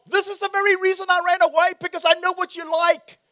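high, loud, fast rant at God; whiny shouted complaint to God; furious Jonah. Acted. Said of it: "And he says to God".

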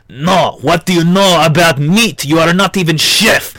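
a mock French boss stressing meat; French accent. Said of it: "the guy goes".

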